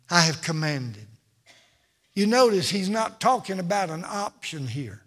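ordinary talk, nothing marked

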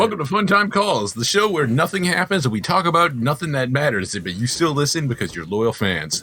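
upbeat podcast host welcome; show host intro. Acted.

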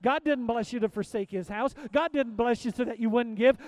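ordinary talk, nothing marked

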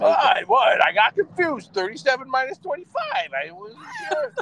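high, flustered mock panic. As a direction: squeak it out, all confused. Acting flustered self after math slip.

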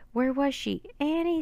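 high, frantic scream of her name; panicked call for his sister. A narrator's reading. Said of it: "screamed Jack".

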